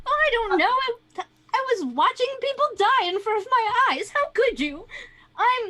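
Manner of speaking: high, wailing indignation; distraught witness. Acted. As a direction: protest as a traumatized Karen, pitch up. Distressed witness under questioning.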